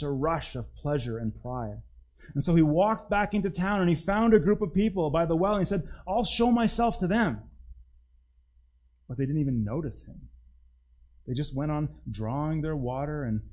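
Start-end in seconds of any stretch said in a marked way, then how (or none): 6.1-7.4 high, loud, puffed-up donkey voice; a cocky, high donkey boasting to the crowd; proud donkey. Acted. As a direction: strut it, full of himself.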